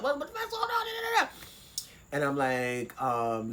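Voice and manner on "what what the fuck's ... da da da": sister yelling. Retold or imitated. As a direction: shout it like she's panicking on the line. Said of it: "she's like".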